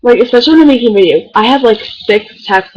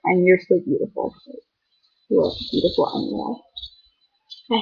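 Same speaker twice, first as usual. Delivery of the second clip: softer, tender reassurance; sweet; 4 semitones lower, 12 dB quieter than usual. Real, not acted. Telling her friend she is beautiful, soft and sweet. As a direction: comfort her gently. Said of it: "I feel like being sweet".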